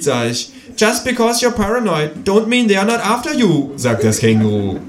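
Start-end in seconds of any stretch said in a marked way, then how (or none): 0.8-3.7 high kangaroo quoting in English. A narrator's reading. Kangaroo quotes Nirvana to the narrator.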